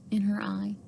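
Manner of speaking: ordinary delivery, nothing marked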